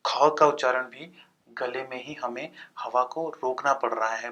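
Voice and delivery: ordinary delivery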